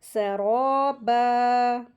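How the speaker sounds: सराबा loud, with rounded, stretched raw; heavy ra. Shown as a demonstration. Teacher models heavy ra, सराबा with long rounded raw. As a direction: thicken and hold the raa of सराबा. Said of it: "इस तरीके से पढ़ेंगे".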